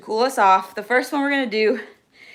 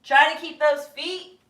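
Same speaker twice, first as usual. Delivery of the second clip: slow, higher, stretched-out instruction; projecting to be heard; 5 semitones higher than usual. Real, not acted. Coach in down dog cueing class, slow projected call. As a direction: call it slowly from the stretch position. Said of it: "so you can hear me".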